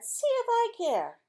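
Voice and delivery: put-on high, slow, huffy kid voice; brother's retort. Brother stomping off with a high, huffy retort.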